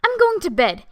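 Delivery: high, loud outburst; exclaiming. A narrator's reading. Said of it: "exclaimed Miss Claythorne".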